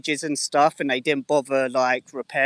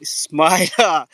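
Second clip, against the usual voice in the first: drawn-out, louder greeting call; 3 semitones higher, 5 dB louder than usual. Real, not acted. Calling out a greeting to Smiler.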